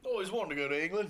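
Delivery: mock-British lilt starting high; bad English accent. Dreaming of England in jest, badly faked English accent. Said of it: "we're terrible at the accent".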